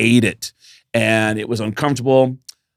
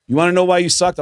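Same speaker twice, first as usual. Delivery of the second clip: blunt critique; 9 semitones higher, 3 dB louder, faster than usual. Retold or imitated. John Domayan critiques him.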